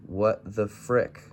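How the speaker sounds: ordinary delivery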